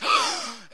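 high sharp gasp; acted gasp. Preacher acting the crucified Jesus gasping. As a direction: gasp like a man suffocating. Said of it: "He can't get air into his lungs".

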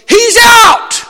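booming umpire's "out"; umpire's out call. Acted. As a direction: call him out like a referee at the plate. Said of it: "peace looks at you and goes".

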